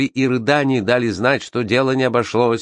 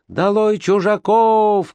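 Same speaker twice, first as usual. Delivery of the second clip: shouting; 11 semitones higher, slower than usual. Acted. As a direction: chant them down, high and drawn out. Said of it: "кричали другие".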